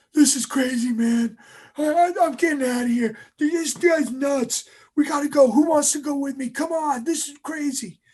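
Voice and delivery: high, loud frantic outburst; acted breaking point. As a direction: imitate a trainee losing his nerve. Quitter breaks in the cold water.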